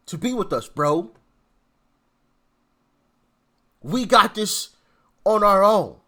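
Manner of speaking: a light imitation with long pregnant pauses between phrases; mocking Hakeem Jeffries. Vlogger mocks Hakeem Jeffries's speech to viewers, with halting pauses. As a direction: parody his halting speech, leaving a heavy pause every few words. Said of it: "the pregnant pauses after every three words".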